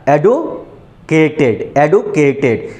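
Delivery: एडुकेटेड in two slow chunks, एडु then केटेड; broken into syllables. Shown as a demonstration. Teacher confirming the break for students, slow and split. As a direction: repeat एडुकेटेड with a gap before केटेड. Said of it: "दो बार में break हो रहा है ना".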